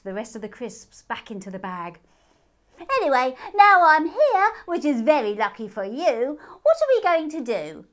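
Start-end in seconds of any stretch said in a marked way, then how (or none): 3.0-7.9 cheeky moon hare. Acted. Moon hare teases P J with a high cheeky voice. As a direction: tease her with each silly name.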